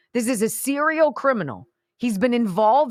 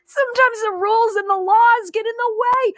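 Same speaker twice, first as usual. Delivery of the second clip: high, whiny mock-crying parody, much higher and louder; mocking whine; 12 semitones higher, 4 dB louder than usual. Host mimics the clip's guest in a mock-crying whine. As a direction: throw his words back in a sobbing whiny voice.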